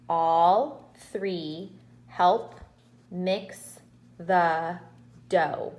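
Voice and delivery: sentence read slowly and deliberately, word by word. Shown as a demonstration. Teacher modelling sentence reading, slowly and deliberately.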